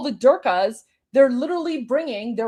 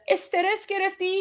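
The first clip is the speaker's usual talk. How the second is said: higher, quick mock concern; mock-caring host; 7 semitones higher, much faster than usual. Imitating the host asking viewers, quick higher mock concern. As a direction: sweetly fake concern. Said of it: "he says".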